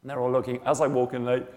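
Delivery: ordinary delivery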